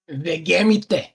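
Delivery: Vegemite said slowly as "veh-geh-mee-teh"; unusual pronunciation. Real, not acted. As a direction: read "Vegemite" letter by letter, every vowel spelled out. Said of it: "That is a wild way of saying Vegemite".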